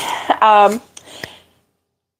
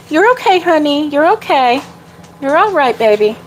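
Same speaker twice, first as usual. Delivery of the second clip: higher sing-song voice soothing a frightened dog; 4 semitones higher than usual. Real, not acted. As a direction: coo to the scared dog, pitched up. Comforting the scared dog.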